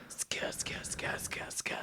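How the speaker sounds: whispered slow-motion sound; whispered ska sound effect. Retold or imitated. Imitated slow-motion skate sound.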